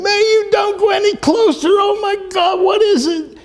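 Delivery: high screechy imitation of a panicked passenger; retold scream. Sis begs the driver not to go closer, retold scream. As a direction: mimic her frantic scream at a very high pitch. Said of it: "And she's screaming".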